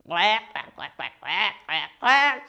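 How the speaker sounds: imitated duck quacks; mallard quacking. A narrator's reading. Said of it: "said the mallard duck".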